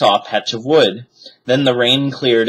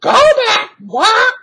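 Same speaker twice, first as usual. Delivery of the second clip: very high, loud parrot squawk; 5 dB louder, slower than usual. A narrator's reading. Parrot warns the children, high squawk. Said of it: "squawked Polly".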